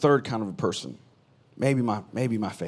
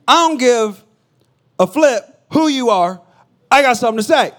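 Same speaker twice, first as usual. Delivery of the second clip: loud, high defiant retort; defiant Martha; 12 semitones higher, 13 dB louder than usual. Retold or imitated. Martha confronting Jesus, loud defiance. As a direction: throw it back at him. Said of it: "She said".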